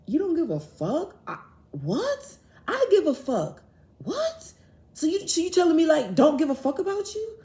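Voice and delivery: high-pitched, incredulous shock; outraged disbelief. Retold or imitated. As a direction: shriek the question back in disbelief. Retold outraged reply to friend.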